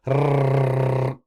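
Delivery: long, high, loud tongue trill; rolled R. Shown as a demonstration. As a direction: trill a sustained R on the tongue tip. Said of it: "practice rolling your R's".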